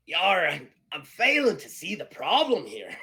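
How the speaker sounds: loud, high pirate voice; Eddie Teach. Acted. Pirate missing the dilemma of the duel, loud high pirate voice. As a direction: belt it out as a hammed-up, high-pitched pirate. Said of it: "Eddie Teach is like".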